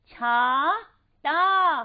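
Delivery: slow, stretched teacher's model word; drawn-out model word. Shown as a demonstration. Teacher to class, stretches छाता slowly. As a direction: hold the word छाता long and clear, sounding out each part. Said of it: "तो बन गया".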